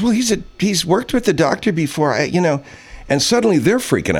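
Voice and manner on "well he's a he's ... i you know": flustered receptionist. Retold or imitated. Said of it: "She says".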